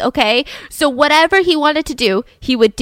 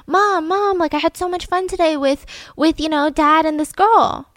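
put-on higher-pitched excited kid telling Mom; imitated child; 5 semitones higher than usual. Child comes home telling Mom about the day, imitated kid voice. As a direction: lightly play a child reporting on the day.

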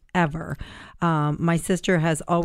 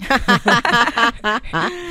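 bright rising laugh; a burst of loud laughter; 5 semitones higher, 5 dB louder than usual. Real, not acted. Laughing at misery joke.

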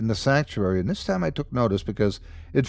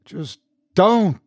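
higher-pitched, urgent plea; 8 semitones higher, 4 dB louder than usual. A narrator's reading. Man stops priest from opening the curtain, urgently. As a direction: cut in with urgency. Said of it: "he said urgently".

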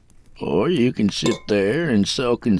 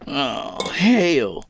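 weary, dismayed groan; 5 semitones higher than usual. A narrator's reading. Kid hearing about the water, groaned. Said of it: "he groaned".

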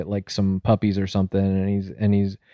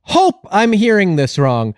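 high-pitched incredulous confrontation; mock outrage; 9 semitones higher than usual. Host confronting Beth about her hidden credit, mock outrage. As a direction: confront them in disbelief, pitch raised.

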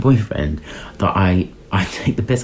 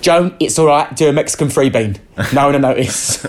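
bossy dinner lady; 5 semitones higher than usual. Retold or imitated. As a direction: hurry the kitchen staff along like a harried dinner lady. Dinner ladies voiced, bossy.

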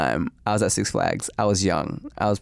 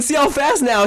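teasing; 11 semitones higher, 4 dB louder than usual. Retold or imitated. Teasing classmate again.

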